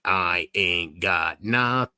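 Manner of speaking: low, very slow drawl; low drawn-out voice. Pretend. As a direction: stretch every word out down low. Same line replayed in two voices.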